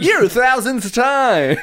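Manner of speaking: high, slow mocking reporter; Chris Hansen voice. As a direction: drag it out like a gotcha host. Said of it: "Chris Hansen comes out".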